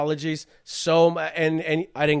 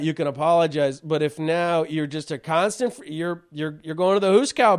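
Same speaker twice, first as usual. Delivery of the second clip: ordinary delivery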